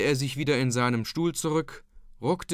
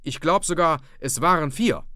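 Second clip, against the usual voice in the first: insistent; 6 semitones higher, 5 dB louder than usual. A narrator's reading. Dike-reeve insists, agitated.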